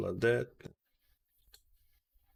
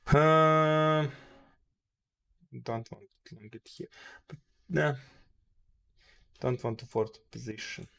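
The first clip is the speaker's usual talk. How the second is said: ordinary talk, nothing marked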